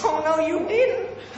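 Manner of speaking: very high, sassy American accent. Shown as a demonstration. Brit trying American catchphrase, high sassy American accent. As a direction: gasp it with sassy American outrage. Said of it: "my best American accent".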